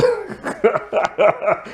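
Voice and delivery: bursting into a high-pitched laugh; a squealing laugh at the silly scene. Real, not acted.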